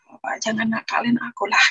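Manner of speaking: ordinary talk, nothing marked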